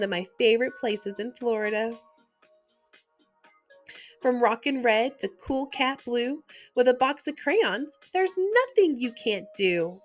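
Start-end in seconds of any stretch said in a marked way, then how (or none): none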